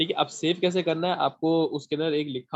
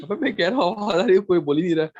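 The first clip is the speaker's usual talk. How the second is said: annoyed; 2 semitones higher, 5 dB louder, faster than usual. Real, not acted. Nobody answering on call.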